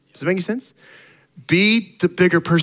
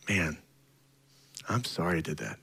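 much softer, low sincere sorry; humble apology; 10 semitones lower, 13 dB quieter than usual. Retold or imitated. Believer apologizing to someone he hurt, soft and humble. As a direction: hush it right down like a real confession.

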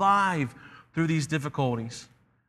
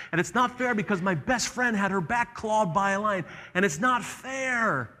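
put-on higher, whiny protest of a child; complaining boy; 5 semitones higher than usual. The boy complains to the lion about his hardships, complaining. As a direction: pile up grievances in a higher voice. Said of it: "begins to complain and say".

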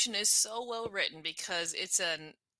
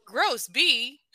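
mock disgust; 6 semitones higher, 6 dB louder than usual. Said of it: "And she's like".

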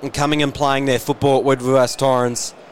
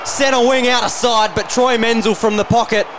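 erupting over a goal; long goal yell; 11 semitones higher than usual. Real, not acted. Goal kicked, commentator erupts.

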